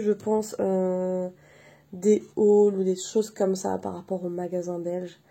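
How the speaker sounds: ordinary talk, nothing marked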